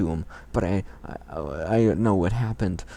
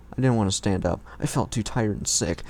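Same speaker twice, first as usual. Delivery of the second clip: normal talking voice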